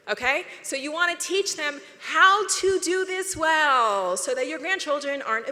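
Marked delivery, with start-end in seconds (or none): 0.6-4.2 slow, loud, insistent teaching point; emphatic shout. Real, not acted. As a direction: drive the point home slowly and loudly. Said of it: "emphatic lesson point".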